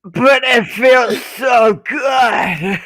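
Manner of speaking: put-on slow, loud, wailing child protest; whiny kid voice. Little boy protesting to his mother from the stall, loud and drawn out. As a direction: drag it out like a kid who won't be hushed. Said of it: "And they go".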